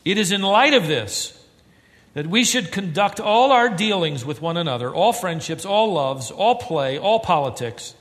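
high, loud building proclamation; climactic preaching. Acted. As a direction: build to the sermon's peak.

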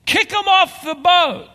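high, loud and slow accusing cry; accuser's demand. Acted.

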